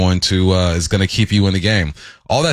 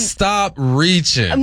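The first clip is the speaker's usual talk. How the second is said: sing-song tease; 8 semitones higher, much slower than usual. Acted. Teasing co-host over misheard word.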